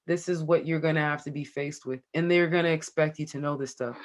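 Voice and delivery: ordinary talk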